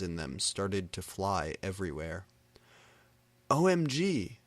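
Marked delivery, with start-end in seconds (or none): 3.5-4.4 high startled O M G; surprised exclaim. A narrator's reading. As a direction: jump at the sight and blurt it out. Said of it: "Satan said, jumping up".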